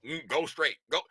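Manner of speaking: low, soft mimicked directions; traffic-directing official. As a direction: imitate an official waving cars on, quietly.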